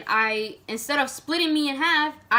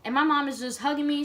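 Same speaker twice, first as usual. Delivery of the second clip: normal talking voice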